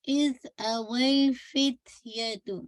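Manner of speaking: इज अवल फित्यतु slow, no bounce on ल; Quran recitation demo. Shown as a demonstration. Teacher to student, slow smooth recitation. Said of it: "लाम पे bounce नहीं कीजिएगा".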